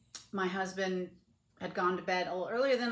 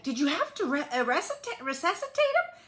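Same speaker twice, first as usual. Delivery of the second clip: astonished question; 10 semitones higher, 6 dB louder, faster than usual. Retold or imitated. Friend's astonished question, acted out.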